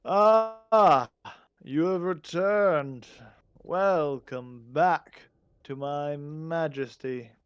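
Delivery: loud, drawn-out kingly welcome; calling out. Acted. Goblin king greets returning adventurers, calling out. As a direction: call out grandly, stretching the words. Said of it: "Digdaz calls out".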